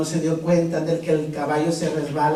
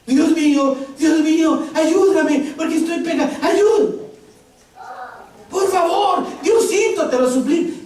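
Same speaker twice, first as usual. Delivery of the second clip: high, louder frantic pleading cries; screaming to God; 12 semitones higher, 4 dB louder than usual. Acted. Hanging man screams to God for rescue, frantic. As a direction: scream for God's help, begging. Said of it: "Ahora sí empezó a gritar".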